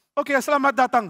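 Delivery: loud, high, exuberant greeting; hearty welcome. Acted. Daud greeting Abiatar before hugging him, exuberant.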